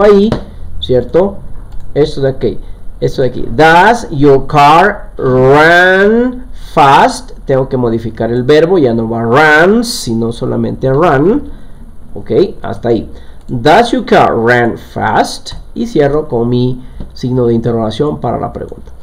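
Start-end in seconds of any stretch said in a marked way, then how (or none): 3.5-7.3 very slow, drawn-out English question; rising, careful dictation; slow model sentence. Shown as a demonstration. Teacher models question slowly. 13.5-15.5 very slow, drawn-out English question; rising, careful dictation; slow model sentence. Shown as a demonstration. Teacher repeats model slowly.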